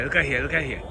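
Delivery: ordinary talk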